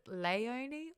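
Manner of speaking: "Leonie" said as LAY-oh-nee, questioning; first pronunciation of Leonie. Shown as a demonstration. Host asks chat how to say the name, "Leonie" as LAY-oh-nee. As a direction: open "Leonie" with a LAY sound. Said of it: "did you notice the pronunciation of Leonie".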